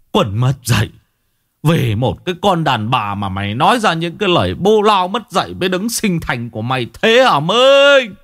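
outraged father berating Minh; furious scolding. Acted.

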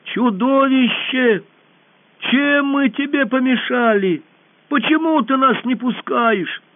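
high, loud, slow call; girl calling to the monster; calling shout. A narrator's reading. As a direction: shout to distract the beast. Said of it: "закричала".

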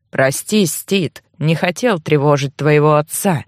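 put-on low, subdued old man; hushed voice of an old uncle; ghostly old man. A narrator's reading. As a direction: apologise low, like a weary elder. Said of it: "старика".

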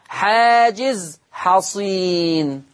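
حاجز حصين drawn out very slowly, every word weighed; slow emphasis. Shown as a demonstration. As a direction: lay stress on حاجز, slow and deliberate. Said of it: "slow emphasis demo". (تأكيد بطيء على حاجز حصين)

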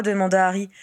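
ordinary delivery, nothing marked

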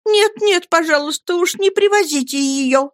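put-on very high, scared girlish begging; frightened Dora begs David, very high; frightened plea. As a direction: beg in a high panicked little voice. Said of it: "с испуганным видом ... взмолилась".

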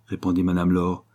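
plain reading voice

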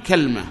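كلمة as "kalma", falling to close the list; short a, no vowel after l in كلمة; third dialect form. Shown as a demonstration. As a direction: say كلمة as kal-ma. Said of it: "ففيه ثلاث لغات".

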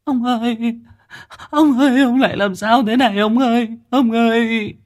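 put-on keen for the dying old man, voice cracking high; sobbing cry. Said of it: "Tiếng mẹ con bà Chiến khóc nấc".

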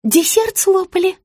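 high-pitched, meek little voice; timid babbling. A narrator's reading. Rita questions her client about dessert, babbling timidly. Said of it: "пролепетала Маргарита".

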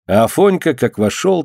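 ordinary delivery

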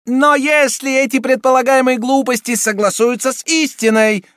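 high, loud enraged prelate; furious exclamation. A narrator's reading. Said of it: "воскликнул в ярости прелат".

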